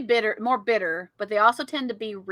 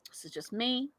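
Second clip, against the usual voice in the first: ordinary delivery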